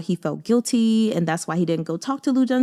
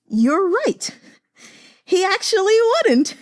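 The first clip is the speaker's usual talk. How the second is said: high, loud, half laughing half crying; real emotional laugh-cry, voice cracking up; overcome, laughing and tearing up at once; laughing through tears; 12 semitones higher, 5 dB louder than usual. Real, not acted. Emotional reaction to the truth.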